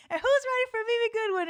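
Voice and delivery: fast, high announcer call; same hype, now for her; hyped host. Retold or imitated. Host calling her name to the room, high and quick.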